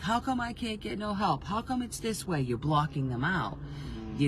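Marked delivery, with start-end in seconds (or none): 0.0-2.4 high, whining mock complaint; whiny complaint. Imitating someone who feels abandoned, in a high whine. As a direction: whine it like a self-pitying victim. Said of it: "you're like".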